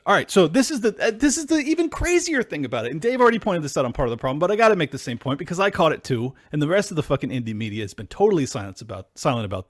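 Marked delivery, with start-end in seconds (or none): none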